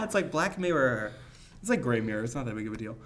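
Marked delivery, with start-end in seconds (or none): none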